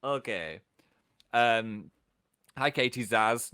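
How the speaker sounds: ordinary talk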